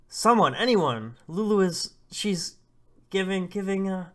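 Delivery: high, very loud frantic call; panicked shout for help. Acted. Father calling villagers as Lulu goes into labour, acted panicked shout. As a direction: shout to the whole village, stumbling over the words.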